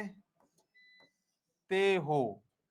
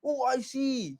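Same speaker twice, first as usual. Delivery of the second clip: higher, softer mock "oh I see"; mock realisation; 5 semitones higher, 5 dB quieter, much slower than usual. Teacher mimicking a student's sudden realisation, mock tone. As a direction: play the girl who just got it. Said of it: "लड़कियों को बहुत तेज याद आता".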